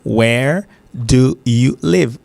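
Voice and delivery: very slow "Where... do... you... live"; slow, careful teaching "Where do you live"; separately, word by word. Shown as a demonstration. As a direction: pronounce each word of "Where do you live" apart so it is understood. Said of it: "séparément pour chaque".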